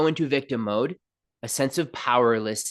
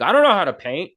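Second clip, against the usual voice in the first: higher, louder mock helpless protest; hammed-up small voice of self-doubt; whiny little self; 5 semitones higher, 7 dB louder, faster than usual. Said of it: "little you human part will come in and be like".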